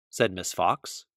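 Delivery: usual speaking voice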